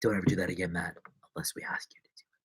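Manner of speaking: soft stern whisper; quiet reprimand to Matt; whispered scolding. Real, not acted. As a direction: hush him while telling off.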